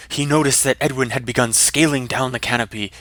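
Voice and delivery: usual speaking voice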